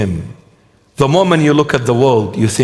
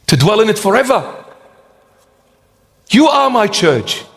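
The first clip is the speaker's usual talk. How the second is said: voicing the Lord; 8 semitones higher than usual. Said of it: "The Lord is saying".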